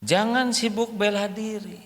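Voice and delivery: ordinary talk, nothing marked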